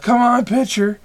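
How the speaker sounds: loud, high heckle from the stands; imitated yell. Imitating players yelling at the pitcher, loud and impatient. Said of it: "and they yelled".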